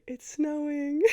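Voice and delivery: high, drawn-out sing-song wonder; acted high-pitched excitement; delighted announcement. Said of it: "there's always someone around to say".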